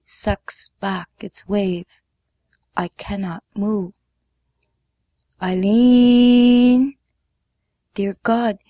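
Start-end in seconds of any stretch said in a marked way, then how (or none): 5.4-7.0 loud distant call, higher; a voice calls Eileen from afar, loud. A narrator's reading. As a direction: call a name across the shore. A voice calling her name.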